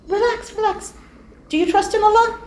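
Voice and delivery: high, soft soothing mother; calm reassurance. Acted. Mother calming her remorseful daughter, soft and reassuring. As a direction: settle her down gently.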